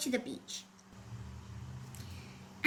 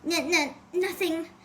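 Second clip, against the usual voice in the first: put-on stammering, frightened girl; nervous stammer; 3 semitones higher than usual. A narrator's reading. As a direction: stumble over the word in fear. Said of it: "Carmen stammered".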